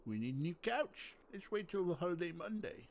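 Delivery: a hint of a Dutch accent. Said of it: "That's my Dutch voice".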